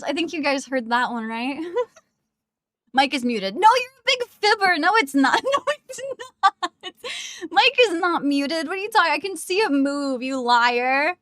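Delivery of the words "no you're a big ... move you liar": louder, high mock-indignant denial; playful protest. Real, not acted. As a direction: tease chat as a big fibber. Mock arguing with chat.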